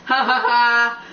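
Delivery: drawn-out villainous ha-ha-ha; acted laugh, stretched out and taunting; gloating laugh. A narrator's reading. Peeves gloats over his trick.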